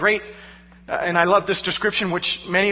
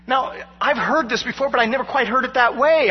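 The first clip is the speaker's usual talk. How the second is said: ordinary talk, nothing marked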